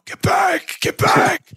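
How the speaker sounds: high shouted coach yell; Tibbs yelling. Retold or imitated. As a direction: bark it like an angry coach on the sideline. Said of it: "Tibbs yelling and screaming at everybody".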